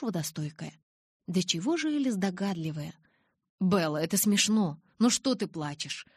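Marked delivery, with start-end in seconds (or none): none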